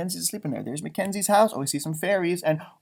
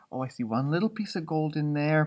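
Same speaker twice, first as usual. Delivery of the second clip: ordinary delivery, nothing marked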